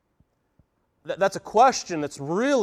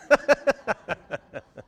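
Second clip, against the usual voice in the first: laugh at own joke; 8 semitones higher than usual. Real, not acted.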